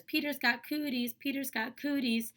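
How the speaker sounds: mocking kid's singsong; child's taunt. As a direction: taunt him like a kid on the playground. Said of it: "she goes around and says".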